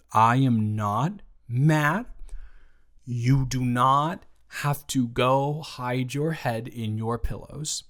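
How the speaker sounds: ordinary talk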